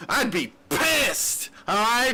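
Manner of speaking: mock outrage. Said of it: "I'm kidding, guys".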